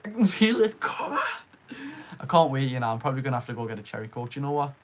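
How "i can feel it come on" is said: excited. Real, not acted. Repeats the phrase, building excitement.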